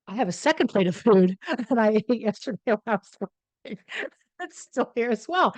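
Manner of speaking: high, laughing through the words; embarrassed laughter breaking into the sentence; laughing confession. Real, not acted. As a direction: confess it while cracking up. Laughs confessing her gross mess.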